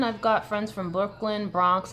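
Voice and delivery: ordinary talk, nothing marked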